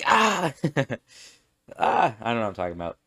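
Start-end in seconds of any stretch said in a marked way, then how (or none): 0.1-0.5 sudden high loud yelp; embarrassed yelp. Real, not acted. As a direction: let out a flustered yelp. Embarrassed outburst, off track.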